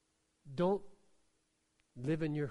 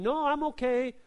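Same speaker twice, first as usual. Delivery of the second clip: higher, louder fake cheerfulness; mock brave front; 6 semitones higher, 6 dB louder than usual. Psalmist pretending nothing bothers him, mock cheerful. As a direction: pretend everything is fine. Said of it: "He wasn't saying".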